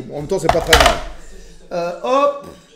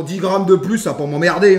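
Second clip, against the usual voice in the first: ordinary delivery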